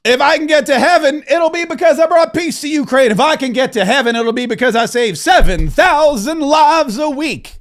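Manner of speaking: a hint of Trump; imitating Trump. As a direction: parrot Trump's words in a light mock voice. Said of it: "he said it yesterday".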